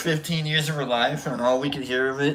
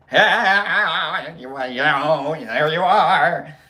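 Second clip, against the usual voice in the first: warbling, drawn-out bad impression; Carol Channing; 6 dB louder than usual. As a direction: quaver through it, hammed-up and stretched. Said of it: "like a Carol Channing".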